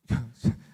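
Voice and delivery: brief loud burst of laughter; short laugh. Real, not acted. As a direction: let out a quick laugh at the memory. Laughs recalling early marriage fights. (짧고 크게 터지는 웃음)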